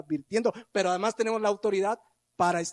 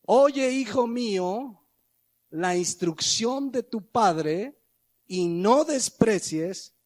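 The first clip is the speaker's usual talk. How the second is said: slow, louder, weighty reading of the verse; solemn scripture reading; 3 semitones higher, 4 dB louder, much slower than usual. Acted. Preacher reading Proverbs to the congregation, slow and solemn. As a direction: let every word land slowly.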